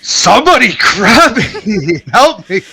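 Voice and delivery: high, hammed-up panicked yell; yelling for help. Player's character fallen in the river, yelling comically for help. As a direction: flail and holler, half laughing. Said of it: "I'm fucking flailing and I'm yelling".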